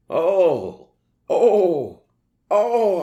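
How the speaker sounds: loud, high mock moans; moaning cries. A narrator's reading. Narrator voicing the heroine's cries, hammed-up loud moans. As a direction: cry out oh, hammed up. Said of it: "I screamed".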